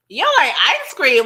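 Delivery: high, loud, incredulous question; hammed-up shock at the very idea; loud mock disbelief. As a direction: blurt it out, pitch swooping up. Repeats question in exaggerated disbelief.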